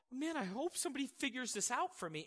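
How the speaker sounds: hope someone else will fix it; mock helpless wishing. Acting out a passive believer.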